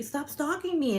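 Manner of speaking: high-pitched mimicry of an accusing child; imitated little girl. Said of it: "that little girl was just like".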